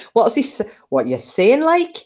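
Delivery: put-on a deliberately poor Geordie accent, pitch jumping up at the end; hammed-up Geordie, a sharp high rise on like; bad Geordie accent. Grandma mocking her grandson's odd book choice in a bad Geordie accent. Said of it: "probably the shittest Geordie accent".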